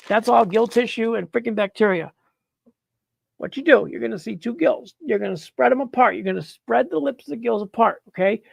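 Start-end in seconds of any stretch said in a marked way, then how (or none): none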